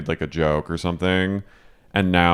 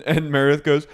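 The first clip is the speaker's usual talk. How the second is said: ordinary delivery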